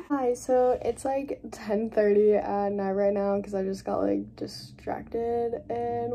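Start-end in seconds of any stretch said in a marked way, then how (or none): none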